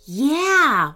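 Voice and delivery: agree, but with a caution; warning. A narrator's reading. Owen cautioning his brother about the magic, warning. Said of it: "warned Owen".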